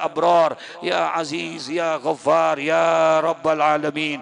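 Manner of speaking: steady chanted Arabic prayer; closing prayer. Acted. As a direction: recite the doa evenly to close. (doa penutup dilantunkan)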